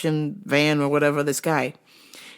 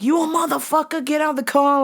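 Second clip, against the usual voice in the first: mock East Coast yell; 10 semitones higher than usual. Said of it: "people being like".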